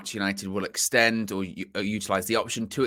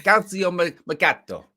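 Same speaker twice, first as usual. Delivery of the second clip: higher, louder amateur Italian accent; attempted Italian accent; 8 semitones higher, 4 dB louder than usual. Shown as a demonstration. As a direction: have a go at "Calcio Mercato" in a put-on Italian accent. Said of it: "I can't really do an Italian accent".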